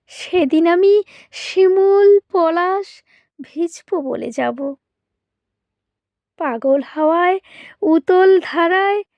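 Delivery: ordinary delivery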